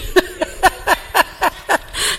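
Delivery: an unvoiced amused laugh; breathy giggle at the gadget; airy laugh. Real, not acted. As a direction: laugh at his own fumbling. Laughing at own fumbling.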